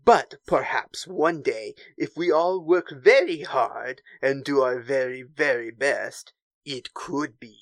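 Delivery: put-on measured, Pepe Le Pew style French accent. Agent Terex answering Phasma about the Empire, French accent. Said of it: "that is the voice".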